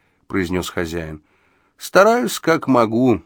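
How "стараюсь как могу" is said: higher-pitched, self-effacing answer; modest reply. A narrator's reading. Jellico answers his master's praise, modestly. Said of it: "скромно откликнулся мистер Джеллико".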